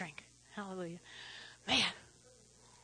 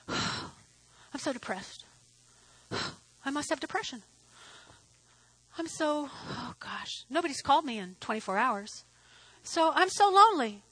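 mock self-pity; 3 semitones lower, 9 dB quieter than usual. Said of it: "Is that, "Nobody called me".

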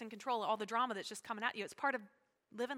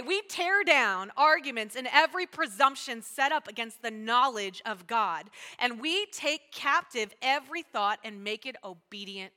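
loud, emphatic verse reading; scripture reading; 7 dB louder, slower than usual. Acted. Preacher reads to congregation, emphatic scripture. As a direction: read the verse out deliberately and firmly.

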